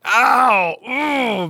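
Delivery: high, loud "ow" sliding into "ooh"; acted-out cry of pain; mock pain yelp. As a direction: yelp and groan over sore hands. Acting out his sore hands.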